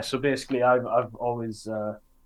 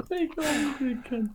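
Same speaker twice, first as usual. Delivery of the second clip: much higher mock copy of a mate's intro line; a teasing, much higher echo of a friend; mocking imitation of a friend. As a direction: parrot it back in a silly high voice to tease him.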